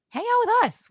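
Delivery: high, loud mock outburst; retold plea. Urging parents to stay near, high loud plea.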